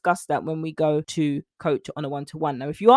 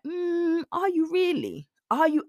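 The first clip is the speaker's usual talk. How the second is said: much higher, drawn-out doubtful; sceptical challenge; 10 semitones higher, slower than usual. Real, not acted. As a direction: tease the client, not buying it. Challenges client's claim.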